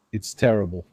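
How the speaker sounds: ordinary delivery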